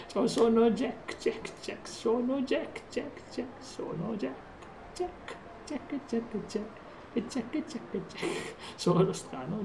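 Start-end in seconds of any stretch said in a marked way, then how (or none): 0.1-1.2 softer, slower put-on voice for Jack; a soft, drawn-out character voice; new character voice. Shown as a demonstration. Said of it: "Ho cambiato faccia". 1.2-8.5 breathy whisper repeating the name over and over; soft whispered chant of the name. Pretend. Repeating the character name, hushed.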